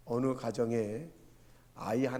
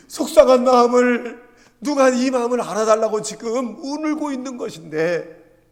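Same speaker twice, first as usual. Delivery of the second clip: very loud, high preaching outburst; impassioned cry; 10 semitones higher, 16 dB louder than usual. Real, not acted. Preacher pleading for the upset child, impassioned shouting.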